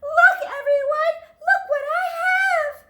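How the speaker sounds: very high, loud, laughing squeal; thrilled shout of look; fierce excited cry. Acted. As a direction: burst out to show it off. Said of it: "Lily whispered fiercely".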